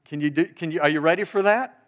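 ordinary talk, nothing marked

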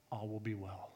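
the Spirit calming a frantic dog owner, hushed. Retold or imitated. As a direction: murmur it like a gentle presence. The Spirit speaking, retold.

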